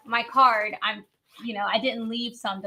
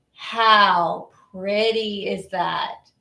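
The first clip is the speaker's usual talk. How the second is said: very slow, lowered gushing; excited low voice; 3 semitones lower, much slower than usual. Real, not acted. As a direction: savour each word, stretched right out. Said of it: "excited when the voice goes down".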